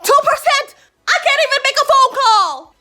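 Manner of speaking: high, loud incredulous cry; hammed-up outraged wail; outraged disbelief. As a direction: exclaim it in loud, high-pitched dismay. Retold panic at dying phone.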